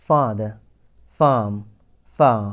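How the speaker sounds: father, farm, far slowly with a long ah. Shown as a demonstration. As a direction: draw out the ah. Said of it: "একটু লম্বা উচ্চারণ করেছি বা long করেছি".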